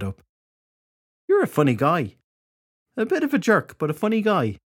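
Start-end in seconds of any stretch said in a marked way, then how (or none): 1.3-4.6 high, teasing tipsy voice; amused, woozy woman. A narrator's reading. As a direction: tease him in a giddy, raised voice. Woozy Alice teasing the detective.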